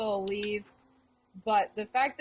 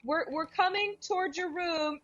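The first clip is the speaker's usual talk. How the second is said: higher, friendly call while approaching; calling ahead; 8 semitones higher than usual. Acted. [name] telling Mary they are coming, higher. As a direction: announce yourself to ease her. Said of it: "I'm gonna say".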